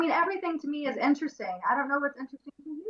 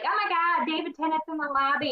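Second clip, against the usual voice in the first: higher-pitched, excited recounted text; mildly excited retelling; 4 semitones higher than usual. Retold or imitated. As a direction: retell a giddy celebrity sighting. Excited text to a friend retold.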